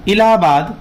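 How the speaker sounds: normal talking voice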